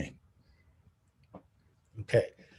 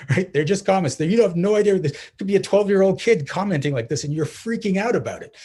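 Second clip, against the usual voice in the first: higher and louder, arguing in disbelief; exasperated; 8 semitones higher, 6 dB louder than usual. Real, not acted. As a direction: push back hard on the overreaction. Exasperated with partner's worry.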